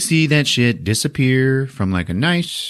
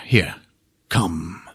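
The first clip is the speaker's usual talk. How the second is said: ordinary delivery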